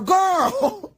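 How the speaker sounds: high, drawn-out words tipping into real laughter; laughing. Real, not acted. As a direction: stretch it out and dissolve into a laugh. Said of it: "I'm laughing".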